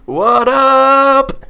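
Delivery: squeaked high and bright; high squeaky greeting. Real, not acted. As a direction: pop the greeting up high, playful. Guest greeting called out, high.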